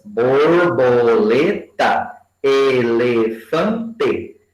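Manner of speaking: slow, each syllable pulled apart; teacher spelling out syllables slowly. Shown as a demonstration. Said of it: "mesmo tanto de sílabas".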